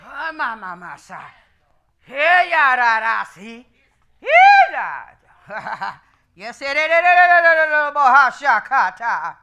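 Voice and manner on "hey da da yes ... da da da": ecstatic chant. Acted. Preacher's ecstatic vocalizing.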